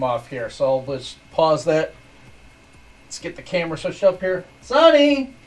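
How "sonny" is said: high, loud call to the dog. Real, not acted. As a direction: shout his name across the room. Calling the dog up.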